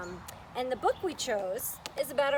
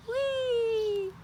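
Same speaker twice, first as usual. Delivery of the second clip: high, squealing swing cry; gleeful whee; 11 semitones higher than usual. Acted. Teacher voicing Peppa and Rebecca on the swing, a high gleeful whee. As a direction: squeal with joy as the swing flies up.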